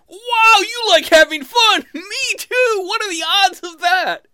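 very high, loud sarcastic gushing; mock excitement. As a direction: squeal as if amazed by a coincidence.

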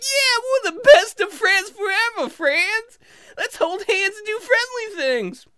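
very high, squealing elf joy; over-friendly squeaky drunk, slowing at the end; happy-talky drunk elf. Acted. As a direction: gush with love in a tiny high voice. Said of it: "I become the happy-talky drunk".

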